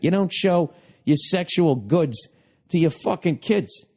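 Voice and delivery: ordinary talk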